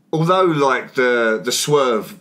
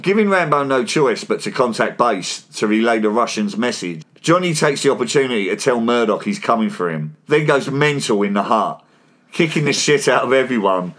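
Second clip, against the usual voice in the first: steady scripted voice-over read; podcast recap read; recap narration; 3 semitones lower than usual. Acted.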